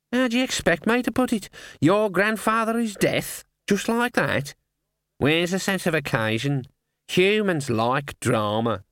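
high lecturing bird voice; raspy cawing crow. Acted. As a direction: scold like a fussy old raven. Raven lecturing.

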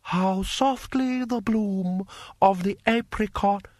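high, slow, delicate poetic recital; polite eunuch reciting. A narrator's reading. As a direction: recite verse as a deferential high-voiced eunuch.

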